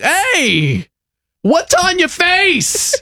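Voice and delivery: imitated neighbor, high-pitched and drawn out; hammed-up horrified holler, slowing on the question; shocked neighbor. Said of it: "He's like".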